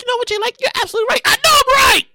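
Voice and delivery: mock listener voice. Host mimics a listener conceding he is right, high mock voice. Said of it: "you're nodding your head like".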